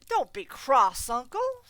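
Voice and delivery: slow, loud, high plea; coaxing nephew. Acted. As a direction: coax the grumpy uncle gently.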